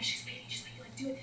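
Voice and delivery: urgent whisper; whispered nudge. Retold or imitated. Kids whisper to their friends to stop, urgent. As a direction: hiss a warning to classmates. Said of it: "elbowing them, like".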